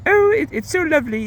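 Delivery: high, chipper mock-British woman; badly faked British accent. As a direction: gush in a high, put-on British voice. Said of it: "I can't do a British accent".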